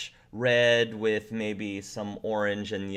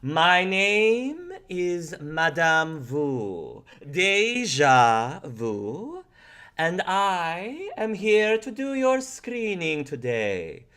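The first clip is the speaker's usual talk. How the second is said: slow, higher, drawn-out seer voice; mystic fortune teller; 6 semitones higher, slower than usual. Acted. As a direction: intone it like a theatrical psychic. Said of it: "And she says".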